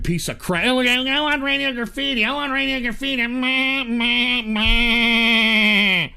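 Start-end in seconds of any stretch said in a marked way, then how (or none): 0.7-3.3 mocking whine. Mocking whining viewers. 3.3-6.1 mocking meh. Mocking viewers' nagging.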